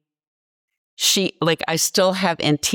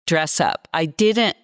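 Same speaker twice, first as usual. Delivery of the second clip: ordinary talk, nothing marked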